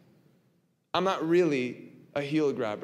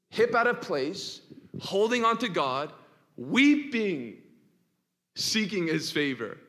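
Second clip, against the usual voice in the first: urgent preaching; 5 semitones higher, 4 dB louder, slower than usual. Acted. Preacher pictures Jacob weeping.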